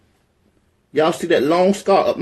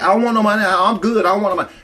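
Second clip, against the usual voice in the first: insistent refusal; 5 semitones higher, 5 dB louder, much faster than usual. Retold or imitated. Insisting she refuses the money.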